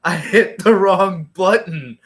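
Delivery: slow, drawn-out mock whine; hammed-up dismay, dragging out the words; mock tearful. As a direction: imitate a wrestler starting to cry over a mistake. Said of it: "he starts crying".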